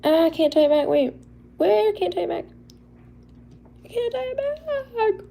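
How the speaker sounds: high-pitched mock wail; playful whine. Struggling to retie a bandana, mock-helpless squeal.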